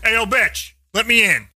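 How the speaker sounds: half-hearted angry call; angry demand. Retold or imitated. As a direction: bark it at the locked door, lightly. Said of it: "'cause I was like".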